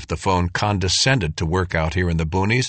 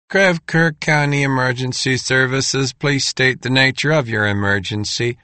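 polite emergency dispatcher; crisp call center greeting; 5 semitones higher than usual. Acted. 911 operator answering the call.

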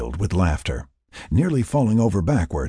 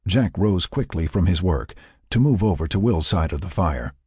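ordinary delivery

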